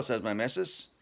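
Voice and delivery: usual speaking voice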